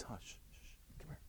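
very soft, voiceless shushing; hushed little aside, imitating the husband; acted hushing. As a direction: shush her in a mock whisper. Retold husband hushes his wife.

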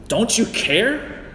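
high, slow, pleading cry of frustration; frustrated cry. Retold or imitated. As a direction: voice the disciples' desperate complaint. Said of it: "I can just hear the frustration".

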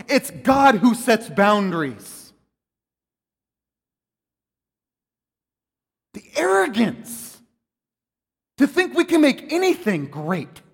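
loud, slow, hammering pulpit cadence; full-force preaching. Acted. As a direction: preach it out with force, leaning on God.

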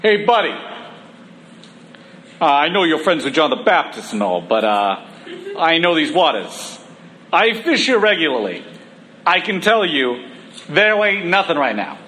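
put-on cocky fisherman with a New York accent. New York fisherman Peter brushing off the preacher's advice, New York accent. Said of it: "why I made Peter a New York".

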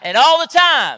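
loud, high preacher call; shouted call. Real, not acted. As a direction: call it out to the crowd.

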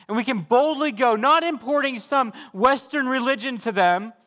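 high, ringing exhortation; rally the church to go boldly; preaching at full force. Acted. Pastor urging his church toward missions, ringing preaching.

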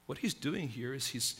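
usual speaking voice

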